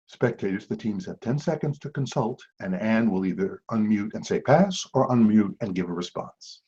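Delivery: hushed golf commentator whisper; soft and low, like announcing a putt; golf announcer voice. Pretend. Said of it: "That was my golf voice".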